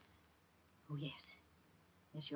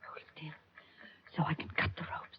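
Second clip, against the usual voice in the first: whispering; 13 dB quieter than usual. Acted. Secret rescue in dark cellar.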